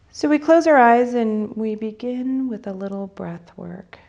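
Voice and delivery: unhurried phrases, leaving space to breathe; guided meditation. Real, not acted. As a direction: lead them gently into breathing and imagery.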